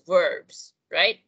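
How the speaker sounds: ordinary talk, nothing marked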